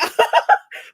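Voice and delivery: breathy high-pitched giggle. Real, not acted. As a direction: giggle loudly. Laughing at story.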